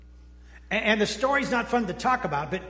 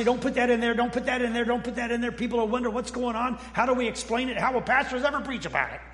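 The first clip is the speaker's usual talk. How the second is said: higher-pitched mock protest, rattled off; worried objector; 4 semitones higher, faster than usual. Pastor imagining himself writing the Bible, mock-worried protest. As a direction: plead in mock alarm, pitched up.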